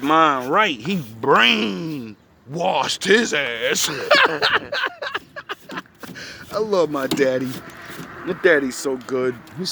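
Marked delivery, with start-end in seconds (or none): 3.9-5.8 high cackling laugh. Real, not acted. Laughing at his own roast of the man.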